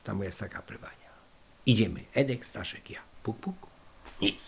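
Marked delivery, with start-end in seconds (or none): 3.2-3.5 whispered knock-knock sound, quiet and high. Retold or imitated. Fugitives knocking at night on the presbytery door, whispered knock. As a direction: tap it out softly in a whisper. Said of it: "knocking at the presbytery door".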